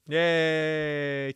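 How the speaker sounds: a loud, happy little cheer; cheering yay. Real, not acted. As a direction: celebrate the win out loud. Cheers at game success.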